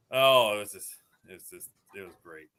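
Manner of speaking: normal talking voice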